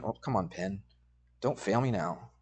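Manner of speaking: low, soft talking to oneself; real offhand mutter, dropped in pitch and volume; muttered aside. Real, not acted. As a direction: quietly coax a failing pen under your breath. Aside to a failing pen.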